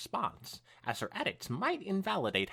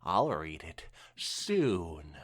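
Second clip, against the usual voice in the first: drawn-out promise; 13 semitones lower, much slower than usual. Acted. Twilight stalling Rainbow.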